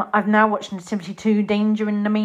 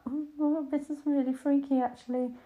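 mock unease; 7 semitones higher, 6 dB quieter than usual. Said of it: "I was like".

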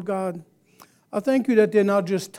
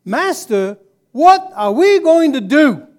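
rising alarmed cry, louder and higher; panicked plea; 6 semitones higher, 7 dB louder than usual. Retold or imitated. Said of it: "So he says".

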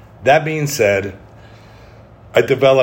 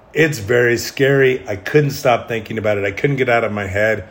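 ordinary talk, nothing marked

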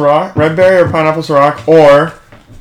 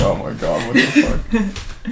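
shocked listener; 5 semitones higher, 7 dB quieter, much slower than usual. Retold or imitated. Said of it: "The podcast people will be like".

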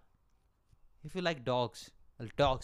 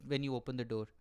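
ordinary talk

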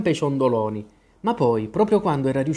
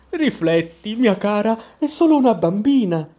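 high-pitched timid pleading; a meek king pleading in a raised voice; 7 semitones higher, 4 dB louder than usual. A narrator's reading. King pleads with his wife for the child, timidly. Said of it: "disse timidamente".

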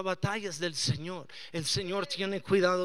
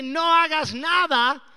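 God's command; 7 semitones higher, 8 dB louder than usual. Retold or imitated. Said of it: "Dios nos dice el contrario y dice".

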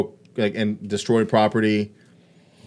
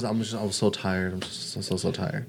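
quiet, mumbled mock exhaustion; mock tired whine; 3 semitones lower, 8 dB quieter than usual. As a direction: mumble it like a worn-out celebrity. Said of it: "And his thing was".

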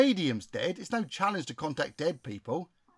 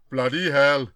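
caricatured spirit chief; 3 semitones lower, 6 dB louder than usual. Acted. Chief reacts to a death.

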